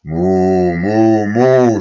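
three cows lowing together; chorus of moos. A narrator's reading. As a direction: cows joining the bellowing. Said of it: "stimmten sie alle drei ein".

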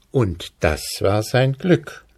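plain reading voice

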